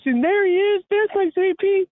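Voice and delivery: she's sure she sees him; delighted old lady. Retold or imitated. Old lady spots her imaginary dog, delighted and high.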